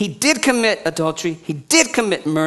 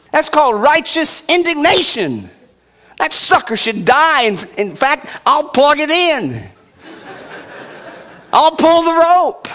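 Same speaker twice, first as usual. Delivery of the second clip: louder, higher preaching on justice; fiery preaching; 7 semitones higher, 5 dB louder than usual. Acted. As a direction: drive it home like a revival preacher. Preaching at full force.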